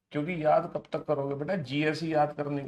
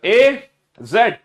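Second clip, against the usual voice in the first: forceful chant, letters punched and stretched; booming start, rhythmic sing-song drill; loud read-along; 6 dB louder, slower than usual. Shown as a demonstration. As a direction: lead the whole class out loud. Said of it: "फिर से पढ़ो मेरे साथ बेटा जोर से बोलकर".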